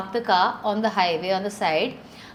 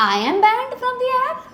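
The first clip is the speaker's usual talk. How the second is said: incredulous; 14 semitones higher, 3 dB louder than usual. Retold or imitated. Told she is banned.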